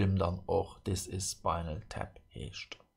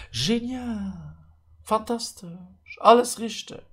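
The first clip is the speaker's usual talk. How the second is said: high, bright showman cheer; excited cheer; 12 semitones higher, 4 dB louder than usual. Pretend. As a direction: announce it like a game show. Celebrating all answers correct. (begeisterter Jubel)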